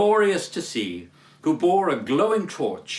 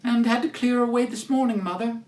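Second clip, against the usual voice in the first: put-on higher, girlish voice; young working girl; 8 semitones higher, faster than usual. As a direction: explain like a tired young daughter. Martha continues.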